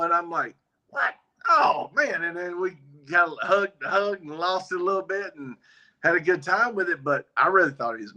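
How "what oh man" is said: surprised joy. Retold or imitated. Reacting to friend finding Jesus.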